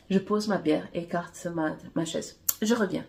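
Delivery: ordinary delivery, nothing marked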